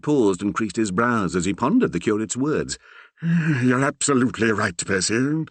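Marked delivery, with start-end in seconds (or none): 3.2-5.4 sighing. A narrator's reading. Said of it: "he sighed at length".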